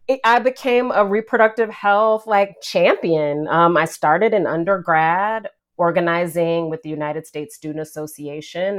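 ordinary talk, nothing marked

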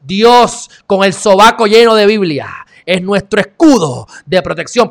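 loud, high declamation; mock preacher's cry. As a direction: thunder it out in mock piety. Mocking pious police slogan as declamation.

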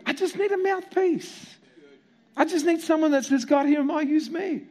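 God passionately reassuring Moses, high and strong; God's reply. Retold or imitated. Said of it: "God's response to his second excuse".

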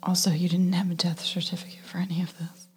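whispering. Real, not acted. Whispering during the movie.

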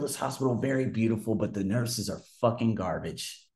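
usual speaking voice

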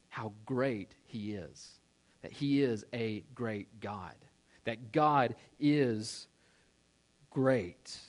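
slow right down and let great land; slow reverent emphasis. Acted. Preacher telling the congregation how great God is, slowly.

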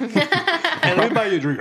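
high, teasing imitation of a guy at a bar; mock bar guy. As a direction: play the pushy guy at the bar, high and mocking. Mocking guys at the bar.

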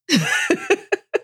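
high bright burst of laughter; real high laugh; delighted laugh. Real, not acted. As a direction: laugh at your own punchline. Laughs after punchline.

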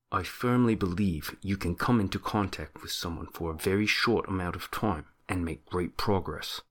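put-on soft mumble in a shaky Australian-Kiwi accent; hushed, mock-serious accent impression; John Danaher. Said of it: "should I do it in the Danaher voices".

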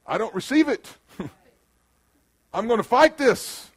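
mock defiant confession.

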